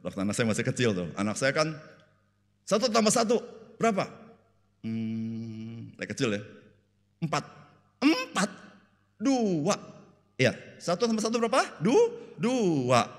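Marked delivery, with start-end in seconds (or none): 2.6-4.1 high-pitched teacherly question; mother drilling the child on sums; quizzing mother. Retold or imitated. 8.1-13.2 higher voiced back-and-forth; mother pressing a guessing child; parent and child drill.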